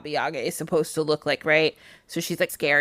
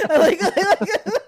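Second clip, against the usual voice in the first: high laughing filler; 11 semitones higher, 5 dB louder, slower than usual. Real, not acted. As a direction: laugh it out loud.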